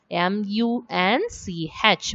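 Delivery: letter by letter, evenly spaced; spelling out letters. Shown as a demonstration. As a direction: spell each letter out separately. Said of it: "What is the spelling for munch?".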